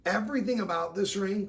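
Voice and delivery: ordinary talk, nothing marked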